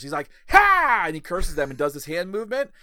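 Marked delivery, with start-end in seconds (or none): 0.5-1.0 high, loud theatrical "ha"; hammed-up villain hex shout; curse cry. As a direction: cast a mock curse with a yelp. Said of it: "he curses the other wrestlers".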